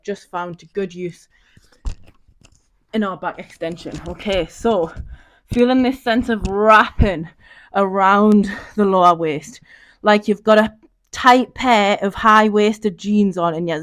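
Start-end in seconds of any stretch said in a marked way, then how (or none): none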